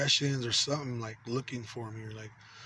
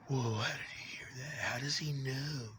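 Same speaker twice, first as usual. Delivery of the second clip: mock whisper; 6 dB quieter than usual. Imitating the voices whispering about him. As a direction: whisper it like an eavesdropper.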